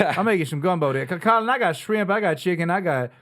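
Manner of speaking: put-on lively, thick Louisiana cook's accent; deep Louisiana accent. Cajun wife listing what's in her gumbo, deep Louisiana accent. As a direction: list it proudly as a Cajun cook. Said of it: "I want, like, a deep Louisiana".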